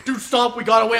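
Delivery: high, loud imitated plea; urgent yell.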